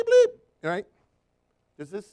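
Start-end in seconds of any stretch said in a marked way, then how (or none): 0.0-0.4 very high, much louder squeaky bleep; mock censor bleep. Shown as a demonstration. Preacher censoring client's curse for church, shrill bleep. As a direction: cover the swear word with a shrill beep. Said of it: "I'm gonna bleep".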